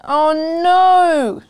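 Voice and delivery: loud, high cry of dismay; boy's startled "oh no" shout; alarmed shout. Acted. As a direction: shout it as the mud soaks through. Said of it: "I shouted".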